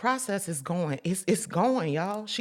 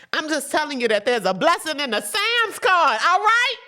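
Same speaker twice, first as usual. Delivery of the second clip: louder, higher, climbing to all right; rising preacher's cry; preaching shout; 10 semitones higher, 7 dB louder than usual. Real, not acted. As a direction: preach it hard to the church. Preaching climax.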